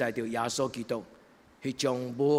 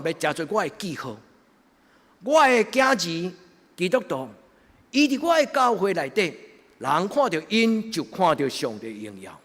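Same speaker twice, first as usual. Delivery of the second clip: preaching; 7 semitones higher, 7 dB louder than usual. Acted. Preaching at full force.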